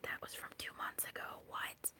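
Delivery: hushed aside in mock disbelief; baffled whisper. Reader reacting to viewers' comments, whispering in disbelief.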